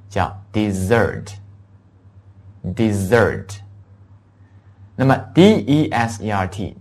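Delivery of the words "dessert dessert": dessert, slow, stressed on the -ssert; stress on second syllable. Shown as a demonstration. Teacher contrasting word pairs: dessert stressed on the end. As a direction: lean on the second half of dessert. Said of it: "重音在S那个地方".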